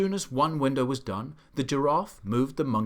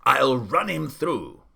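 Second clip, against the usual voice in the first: louder, slow threatening bluster; blustering shout; 8 dB louder, slower than usual. A narrator's reading. The Duke brandishing his sword, shouting a slow threat. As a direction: bluster like an old soldier. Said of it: "he shouted".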